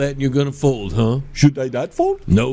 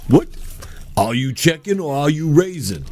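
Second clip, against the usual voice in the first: ordinary talk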